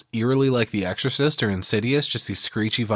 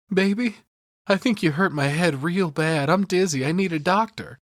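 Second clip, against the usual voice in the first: higher, whiny voice of a hurt husband begging; pleading for sympathy; 8 semitones higher than usual. Retold or imitated. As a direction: play the wounded man so she pities you. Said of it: "he tries to play on Wendy's sympathy".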